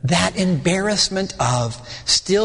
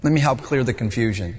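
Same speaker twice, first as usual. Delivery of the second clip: ordinary talk, nothing marked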